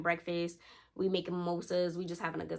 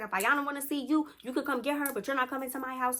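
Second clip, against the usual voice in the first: going off; 7 semitones higher than usual. Retold or imitated. Tasha going off on Crazy, high and heated. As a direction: lay into her, voice up high. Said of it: "she was just going off on her".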